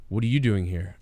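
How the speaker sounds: low, quiet, deadpan question; mock sudden calm; Kurt Angle. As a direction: drop right down, unbothered. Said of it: "then he turned to Daniel Bryan and said".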